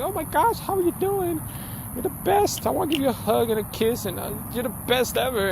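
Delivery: very high, gushing mock fan voice; hammed-up adoring fan. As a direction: squeal it like a starstruck fan. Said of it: "Everybody's like".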